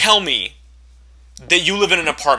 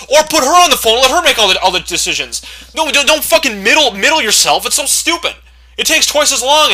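higher, louder fed-up ranting; exasperated rant; 7 semitones higher, 5 dB louder than usual. Real, not acted. Order taker ranting about indecisive callers, loud and high. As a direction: vent the frustration.

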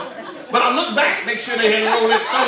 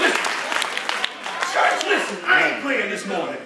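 louder, higher, shouted sermon climax; full-throated preacher build; preaching at full force; 6 semitones higher, 3 dB louder than usual. Acted. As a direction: drive it home to the church with rising fire. Preaches the closing exhortation.

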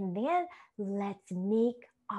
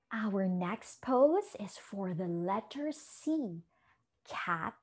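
ordinary talk, nothing marked

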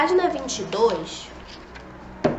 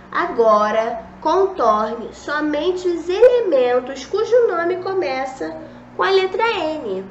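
slow, higher, louder textbook reading; reading the instructions; 4 semitones higher, 4 dB louder than usual. Acted. Teacher reading the workbook task aloud to pupils, slow and raised. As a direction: read the booklet's instruction out clearly and slowly. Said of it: "tá pedindo aí na apostila".

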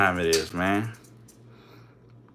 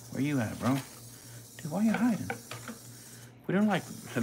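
high, soft coaxing of the hiding mouse; 6 semitones higher, 9 dB quieter, faster than usual. Real, not acted. Coaxing the hiding mouse in a high soft voice. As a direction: lure it out gently.

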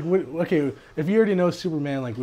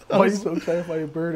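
laughing through the words; real wheezy giggling; helpless laughter; 3 semitones higher, faster than usual. Real, not acted. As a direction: squeeze the question out between laughs. Repeats question while laughing.

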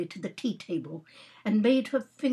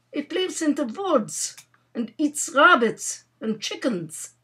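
put-on light Russian accent, higher pitch; 7 semitones higher than usual. Russian youth describing the animal to Nora, Russian accent. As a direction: innocent explanation with a hint of Russian accent. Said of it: "Russian youth whom she had brought down".

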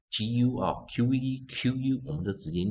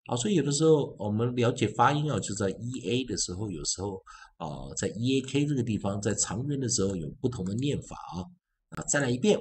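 ordinary talk

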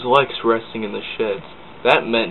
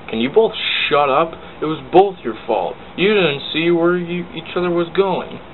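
scolding; 7 semitones higher, 3 dB louder than usual. Acted. Percy scolds both engines.

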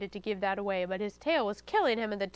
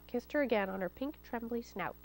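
ordinary delivery

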